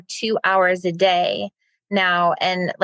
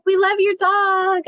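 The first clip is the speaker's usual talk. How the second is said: gushing way up high; complimenting a stranger's dog, high and gushing; 12 semitones higher than usual. Retold or imitated. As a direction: coo it brightly and high. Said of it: "We're like".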